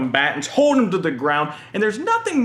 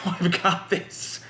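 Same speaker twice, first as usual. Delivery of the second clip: cocky confidence; 4 dB quieter than usual. Retold or imitated. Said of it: "And we think".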